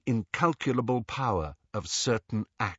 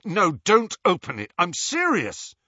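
raised, higher urgent plea; alarmed protest; 7 semitones higher, 5 dB louder than usual. A narrator's reading. Ron begging Harry not to open it, alarmed. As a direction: insist on stopping him.

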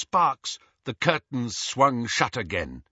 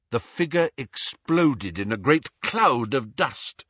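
ordinary delivery, nothing marked

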